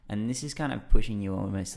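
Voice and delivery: ordinary delivery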